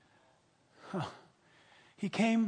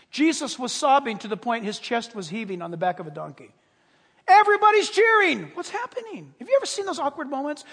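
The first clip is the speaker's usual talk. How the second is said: normal talking voice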